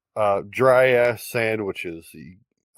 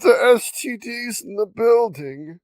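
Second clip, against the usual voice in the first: put-on old person voice; 10 semitones higher than usual. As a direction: play a complaining old resident. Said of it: "retirement episode".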